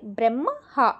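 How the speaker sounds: బ్రహ్మ హా broken up, the m sounded before the h; split pronunciation. Shown as a demonstration. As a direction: say the m of బ్రహ్మ first, then a separate హా. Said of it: "మాని first చదివాక తర్వాత హాని చదవాలి". (బ్రహ్మ హా: ముందు మ, తర్వాత హ పలకాలి)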